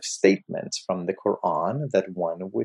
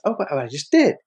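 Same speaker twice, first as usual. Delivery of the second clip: higher, louder protest; acted protest from a pupil; 5 semitones higher, 5 dB louder, faster than usual. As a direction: blurt out an objection in mild protest. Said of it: "the man protests and says".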